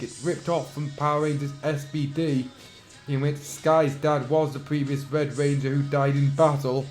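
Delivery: ordinary talk, nothing marked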